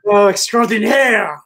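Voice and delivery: playful French accent on extraordinaire. Shown as a demonstration. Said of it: "Use the word extraordinaire".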